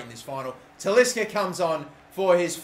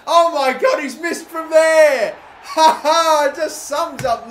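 loud, high shout breaking into a laugh; laughing disbelief; 11 semitones higher, 11 dB louder, slower than usual. Real, not acted. Gamer laughing at Liverpool's open-goal miss, loud and high.

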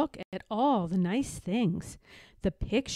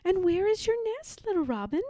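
higher, gentle little-cub question; curious little cub; 10 semitones higher, faster than usual. A narrator's reading. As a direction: ask sweetly. Cub asking baby robin.

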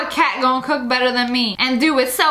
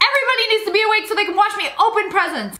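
put-on excited kid; 7 semitones higher than usual. Imitating every kid on Christmas.

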